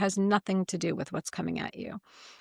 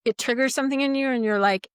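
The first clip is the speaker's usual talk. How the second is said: usual speaking voice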